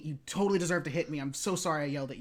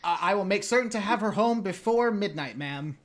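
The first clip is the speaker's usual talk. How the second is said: rehearsed polite promise; 3 semitones higher, 7 dB louder than usual. Acted. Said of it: "it seemed rehearsed".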